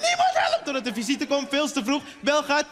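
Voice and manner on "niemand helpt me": high, loud, fast mock yell; father ranting. Father yelling behind mother in the kitchen, frantic mock shout. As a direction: ham up the frantic father screaming he does everything. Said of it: "En mijn vader staat erachter".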